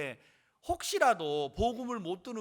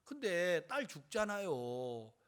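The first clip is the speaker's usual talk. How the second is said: ordinary talk, nothing marked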